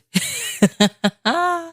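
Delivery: high nervous giggle. Real, not acted. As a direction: giggle at the memory. Laughing at ghost story.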